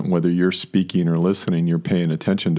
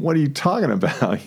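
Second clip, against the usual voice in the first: higher, incredulous question; mock bafflement; 7 semitones higher than usual. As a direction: act baffled at the denial. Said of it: "you're kinda going".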